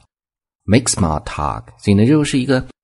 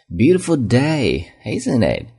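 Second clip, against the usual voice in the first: friendly small-talk tone; 6 semitones higher, slower than usual. Shown as a demonstration. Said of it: "这种语气很重要".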